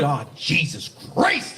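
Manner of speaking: satisfying exclamation. Shown as a demonstration. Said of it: "it just feels good".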